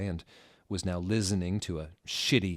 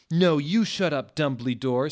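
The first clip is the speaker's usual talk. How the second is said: yelled comeback; 7 semitones higher, 8 dB louder than usual. Acted. Tom yelling back.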